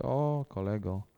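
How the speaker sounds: slow, low and soft, a lightly wary aside; a hint of uneasy surprise under the breath. Reaction on seeing the guru. (lekko zaniepokojone, ciche zdziwienie)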